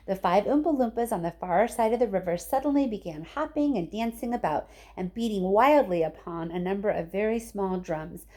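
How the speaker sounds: usual speaking voice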